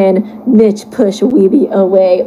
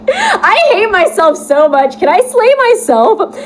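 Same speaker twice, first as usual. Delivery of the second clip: cringing laugh; 9 semitones higher than usual. Real, not acted. Reacting to fanfic, cringing.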